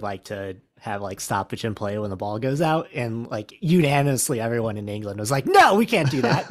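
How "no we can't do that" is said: loud, high mimicked protest; exaggerated indignant objection; mock outraged refusal. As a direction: imitate a crowd flatly refusing, pitched up. Mimics England's unanimous refusal.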